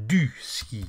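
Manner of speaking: normal talking voice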